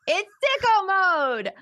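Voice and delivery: high, drawn-out triumphant call; hyped announcement. Real, not acted. Streamer unveils the top tier to chat, hyped. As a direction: call it out slowly and high, hyping it up.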